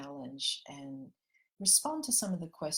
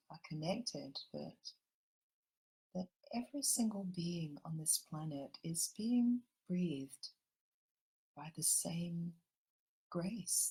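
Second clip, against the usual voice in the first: gentle meditation guide drawing everyone into the heart; guided meditation; 4 dB quieter than usual. Acted. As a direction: ease the listeners into calm, unhurried.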